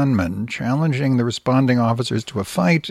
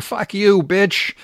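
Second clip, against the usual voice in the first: higher, louder mock outburst; riled-up child; 8 semitones higher, 4 dB louder, slower than usual. Host quoting the boy cursing at the reading specialist, mock outburst. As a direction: fling it out like a riled-up kid. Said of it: "The boy became riled up again".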